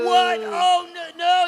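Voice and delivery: loud high What and Oh no; hammed-up alarm, loud and high; panicked shout. Haman caught out.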